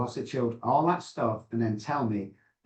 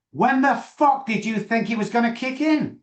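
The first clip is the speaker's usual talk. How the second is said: high, loud mock disbelief; incredulous outburst; 11 semitones higher, 5 dB louder than usual. Asking a client why six years of meds haven't worked, loud and incredulous.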